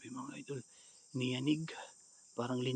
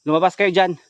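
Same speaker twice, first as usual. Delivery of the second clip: high, very loud order; commanding shout; 6 semitones higher, 17 dB louder than usual. Real, not acted. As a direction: order someone out of hiding. Orders hidden people out. (pasigaw na utos)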